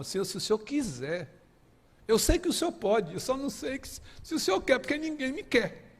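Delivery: a rejected man's high, aching plea to the Lord; anguished plea. Retold or imitated. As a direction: plead as if nobody wants you. Leper pleads, feels unwanted.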